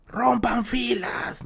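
high, drawn-out mock shout; imitated yell. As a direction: imitate a kid calling out across the schoolyard, held back in volume. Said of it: "Gritó, yo, gritó, gritó".

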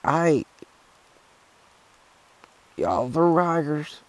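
put-on high, slow old hiker voice; a hammed-up old trail guy. Old guy voiced in hiking story.

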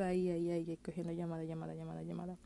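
fast, low run that fades away; nonstop repetition. Shown as a demonstration. Mimics nonstop back-to-back calls. (retahíla rápida, cada vez más baja)

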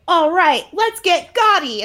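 loud, high, slowed rallying call; rally the team; pumped-up team cheer; rallying cry. Retold or imitated. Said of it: "she's like".

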